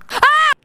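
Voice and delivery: short, high-pitched shriek; startled scream. Real, not acted. Streamer scared as doors open, startled scream. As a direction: shriek as if jumped on. Jump scare while playing.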